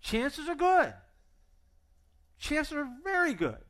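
high, drawn-out warning; wry emphasis. Real, not acted. Pastor telling the congregation the odds, high and wry. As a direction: stretch it for the joke. Comic emphasis on unlikely marathon.

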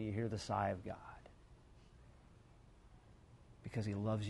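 hushed, slowing down to a whisper; soft reflective preaching. Acted. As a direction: draw the listeners into stillness. Quiet reflective close.

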